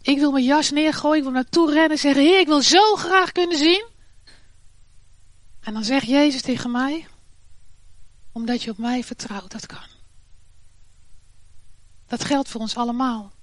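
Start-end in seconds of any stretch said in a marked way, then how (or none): 2.2-3.9 higher and louder, rising on zo; eager cry. Pretend. Preacher cries out himself. 8.3-9.9 softer, fading almost to nothing; gentle reply. Pretend. Jesus' gentle answer.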